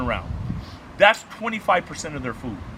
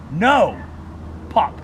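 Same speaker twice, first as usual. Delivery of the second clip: retold correction shout; slower than usual. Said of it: "He goes".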